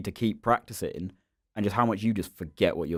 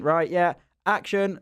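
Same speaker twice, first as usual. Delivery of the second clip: lightly imitated director calling action, higher pitch; director's call; 9 semitones higher, slower than usual. Crew starting the short film take, imitated higher-pitched call. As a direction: mimic the cue to start a take. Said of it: "but they went".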